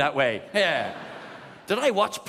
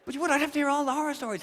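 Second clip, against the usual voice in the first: fast, higher rushed aside; rapid aside; 9 semitones higher, faster than usual. Real, not acted. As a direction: rattle it off quickly.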